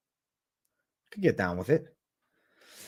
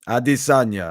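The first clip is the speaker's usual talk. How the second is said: put-on low Brazilian Portuguese accent; Pereira's Brazilian accent; 4 semitones lower than usual. Fighter calling out Adesanya, low Brazilian accent. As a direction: intone the rival's name in a Brazilian accent. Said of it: "The Pahea accent".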